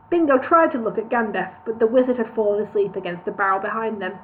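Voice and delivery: ordinary delivery, nothing marked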